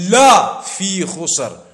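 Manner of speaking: la punched and held long; slow, with la set apart; stress on la. Shown as a demonstration. Preacher listing the third emphasis word, stress on la. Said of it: "then the lam".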